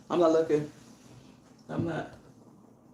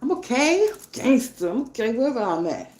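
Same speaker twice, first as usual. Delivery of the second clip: protesting self; 6 semitones higher, 4 dB louder than usual. Retold or imitated. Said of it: "I was like".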